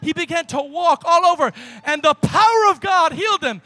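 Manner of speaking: loud, driving sermon delivery that builds to a shout; full-force preaching. Acted. Pastor to the congregation, telling the healing in a loud preach. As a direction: preach it to the rafters, pushing every line.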